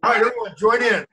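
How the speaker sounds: loud, cheery call to sing along; rally the room to join in. Acted. Leading kids in pirate song.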